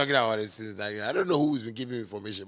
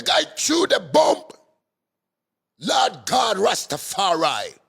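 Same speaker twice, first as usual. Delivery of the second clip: much higher and louder, hammed-up anguished news; mock pained reporter; 10 semitones higher, 9 dB louder than usual. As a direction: parody a reporter wailing the news. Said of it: "you people talk with pain".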